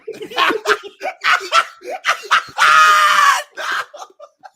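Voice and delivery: loud high-pitched laughing fit; shrieking with laughter. Real, not acted. Crowd erupts at Noah leaving.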